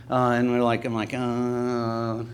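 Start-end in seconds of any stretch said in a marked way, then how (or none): 1.1-2.2 long, low monotone "uhhh"; drawn-out soft groan of irritation; annoyed groan. Acted. Preacher mimics people being told off, annoyed groan. Acts out listeners' annoyed reaction.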